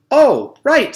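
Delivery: high, loud "oh, right" of dawning understanding; sudden realization. A narrator's reading. Teacher gets Mac's point, sudden high realization. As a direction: catch on all at once.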